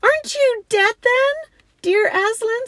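high, loud astonished question; frightened and glad. A narrator's reading. Lucy to the lion she thought dead, amazed. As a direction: ask in a high child's voice, amazed. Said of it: "as much frightened as they were glad".

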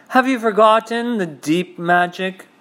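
high, cutting witch accusing; cold witch voice. Acted. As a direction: accuse him like a cold queen. Witch challenges Aslan.

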